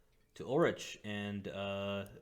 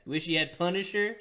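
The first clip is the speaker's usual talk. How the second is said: high put-on innkeeper voice; 9 semitones higher, 9 dB louder than usual.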